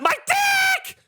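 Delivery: high-pitched scream of pain; very high, strained yelp; retold scream. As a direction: reenact screaming out in sudden agony. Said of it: "I screamed".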